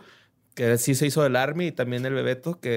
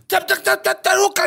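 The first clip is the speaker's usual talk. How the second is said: fighting game shout; 4 dB louder than usual. Retold or imitated. Imitating game sounds and move.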